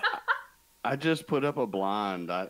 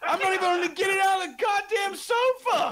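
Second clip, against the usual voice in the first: high, loud, laughing yell; exasperated laughing outburst; laughing shout; 6 dB louder than usual. Real, not acted.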